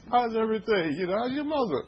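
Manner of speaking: mock-sweet fake friendliness; changed tune. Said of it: "you just change your tune and says".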